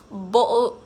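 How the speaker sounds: loud British "Bottle" with the T swallowed; British accent. Shown as a demonstration. Streamer playing jester for viewers, British accent. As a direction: punch out a glottal British accent. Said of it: "say bottle of water in British accent".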